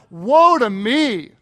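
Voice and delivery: loud, drawn-out retold lament; slow, stretched cry of woe. Preacher quoting Isaiah before God, slow loud cry. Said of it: "he cries out".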